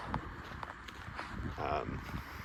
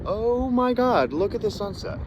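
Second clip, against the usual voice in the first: breathless amazement at the sunset; awestruck; 12 semitones higher than usual. Real, not acted. Awe at view from tower.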